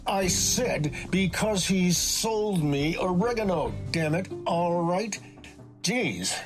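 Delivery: exasperated repeat. A narrator's reading. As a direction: spell it out, fed up at being misheard. Exasperated boy repeats his answer.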